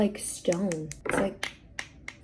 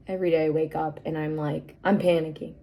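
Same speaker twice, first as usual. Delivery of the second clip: usual speaking voice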